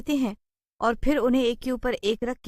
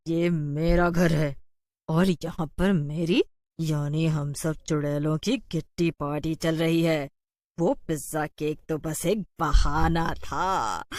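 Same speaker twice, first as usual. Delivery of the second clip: witch voice; 2 semitones lower than usual. Acted. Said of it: "उनसे कहती है". (धीमी धूर्त चुड़ैल की आवाज़)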